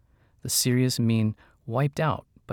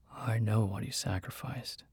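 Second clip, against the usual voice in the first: husky; 7 dB quieter than usual. A narrator's reading. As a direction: say it softly, voice husky. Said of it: "in a husky voice".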